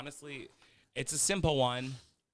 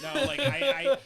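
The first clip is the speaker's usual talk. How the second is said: excited outburst; 13 dB louder, slower than usual. Real, not acted. Excited declaration of readiness.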